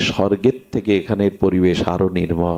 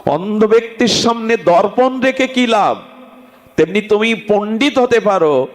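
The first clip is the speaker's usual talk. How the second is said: preaching at full force; 9 semitones higher than usual. Acted. Preacher urging the congregation to worship Gaura, impassioned. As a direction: rouse the crowd to worship Gaura.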